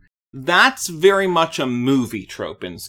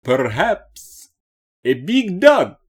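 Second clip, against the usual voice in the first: slow, high hammy Dracula drawl; fun vampire voice; 6 semitones higher, slower than usual. Acted. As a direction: ham up a silly vampire host. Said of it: "A little bit of a fun little vampire voice".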